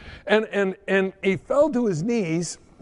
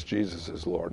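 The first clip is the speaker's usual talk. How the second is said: much lower and softer "Jesus is Lord"; quiet relief; 11 semitones lower, 9 dB quieter than usual. Retold or imitated. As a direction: say it calmly, the fight over. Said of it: "And he goes".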